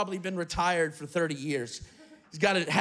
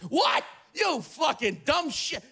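put-on old New Yorker shouting; 5 semitones higher, slower than usual. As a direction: blast it like a cranky old Brooklyn guy. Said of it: "He's just shouting it".

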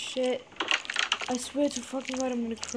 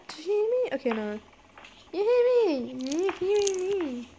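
playful high-pitched call; 7 semitones higher than usual. Pretend. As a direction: call out in a raised, teasing pitch. Calling to game character, pitched up.

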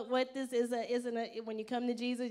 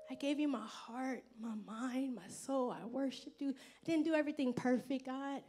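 soft, hushed prayer to God, whispering at times; tender prayer; 4 dB quieter than usual. Retold or imitated. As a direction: pour your heart out quietly. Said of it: "stand before God and be like".

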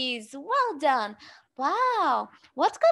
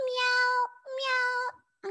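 meows pitched up high; high cat meow; 7 semitones higher than usual. Pretend. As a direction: squeak the meows up like a kitten. Repeated cat sound, high take.

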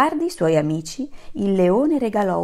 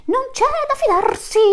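much higher, louder echoing chorus; chorus of antelopes; 5 dB louder than usual. Acted. The other antelopes echo their leader, high and loud. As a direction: squeak it back like the whole herd. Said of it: "ripeterono in coro le altre".

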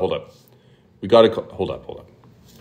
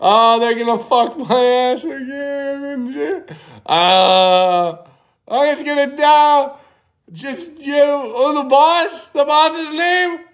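mock panic; 5 semitones higher than usual. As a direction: pitch climbing into hammed-up panic. Escalating fake panic in prank.

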